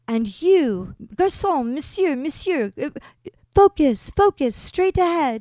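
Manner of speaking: loud, high-pitched commands, imitated; bossy tour guide. Tour guide ordering [name] to focus, loud and bossy. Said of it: "woman who was in charge of the tour".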